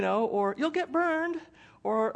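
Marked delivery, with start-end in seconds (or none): 0.6-1.4 higher mock fretting warning; mock nagging parent. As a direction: play the worried parent at the tree.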